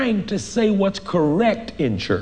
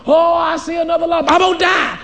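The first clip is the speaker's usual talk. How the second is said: loud, high panicked cry over a lump; panicked wail; 10 semitones higher, 5 dB louder than usual. Retold or imitated. Patient hearing about a lump, panicked wail. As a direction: wail it like bad news just hit.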